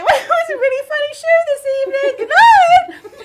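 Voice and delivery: high, loud tipsy cheer. Acted. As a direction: drunk and innocent, very high. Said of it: "And they're like".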